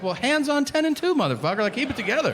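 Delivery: high-pitched urgent order to a stoned driver; mock-panicked passenger taking charge; alarmed scolding.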